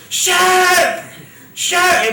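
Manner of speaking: high, loud screamed Shirk; retold scream. Brother fleeing down the street, high screaming. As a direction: yell it like you're running away. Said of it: "running down the street screaming".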